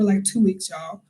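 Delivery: ordinary talk, nothing marked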